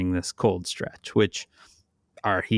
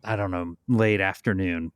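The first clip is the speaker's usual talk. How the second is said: ordinary talk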